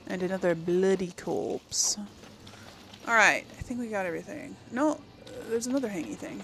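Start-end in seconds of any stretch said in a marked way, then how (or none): none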